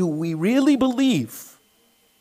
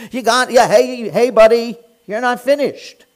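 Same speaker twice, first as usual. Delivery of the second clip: a mock-urgent Esther, raised voice; loud demanding plea; 3 semitones higher, 7 dB louder than usual. Esther confronting the king, loud demanding plea. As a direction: grab his sleeve and refuse to let go.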